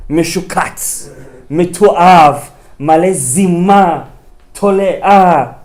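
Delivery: loud, slow list of insults; scornful insults. Acted. Preacher condemning Potiphar, loud slow scorn. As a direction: spit out each insult.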